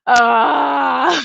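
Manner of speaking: bursting out laughing, loud and high; real laughing yelp; laughing shout. Real, not acted. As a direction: crack up out loud. Laughing outburst at chat question.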